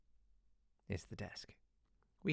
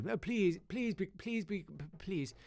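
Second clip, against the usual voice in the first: pleading teacher; 7 semitones higher than usual. Retold or imitated. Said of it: "pleading teacher".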